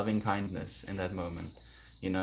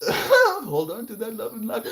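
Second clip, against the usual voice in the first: high squeaky laughing protest; pushing the love away through giggles; 13 semitones higher, 7 dB louder than usual. Real, not acted. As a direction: laugh while begging them to take it back.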